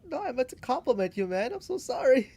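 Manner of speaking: high, loud mock apology; hammed-up backpedalling friend; flustered apology. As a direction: apologise in a rush, voice jumping up.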